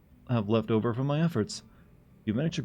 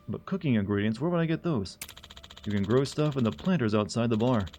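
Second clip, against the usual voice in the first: ordinary talk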